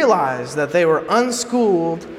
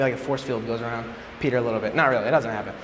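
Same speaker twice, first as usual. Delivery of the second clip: ordinary talk, nothing marked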